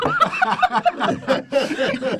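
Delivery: loud high cackling laugh; high burst of laughter. Real, not acted. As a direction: crack up laughing. Big laugh at joke.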